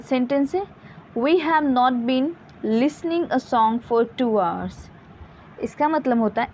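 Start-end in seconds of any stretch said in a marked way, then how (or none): 1.2-4.9 each word read out very slowly; slow example read. Shown as a demonstration. Teacher to students, reading the example very slowly. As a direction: spell the sentence out slowly for the class. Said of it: "reading example sentence to students".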